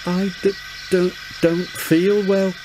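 quavering. Acted. Sir Handel faking sickness to the controller, quavering. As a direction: fake a weak shaky voice. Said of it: "quavered Sir Handel".

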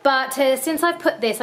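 normal talking voice

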